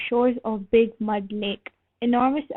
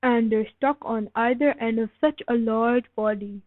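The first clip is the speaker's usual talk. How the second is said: ordinary delivery, nothing marked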